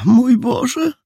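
high, plaintive exclamation; helpless, fretful sigh of dismay; sighing landlady. A narrator's reading. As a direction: lament it in a high woman's voice. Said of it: "westchnęła pani Lichoniowa".

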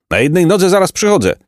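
high, quick comic voice; comic bandit calling. A narrator's reading. Funny-faced bandit answers roll call, calling in comic high voice. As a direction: sing out the rhyme eagerly. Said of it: "wołał zbójca z bardzo śmieszną".

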